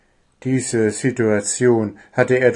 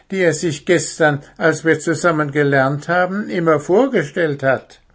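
higher little owl voice, eager; young owl explaining to the teacher; 8 semitones higher, 4 dB louder than usual. Acted. Willi explains.

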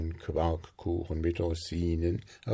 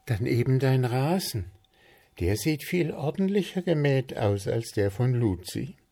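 teasing Zwitschi; 6 semitones higher than usual. Acted. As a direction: poke fun at his endless planning. Zwitschi suggests.

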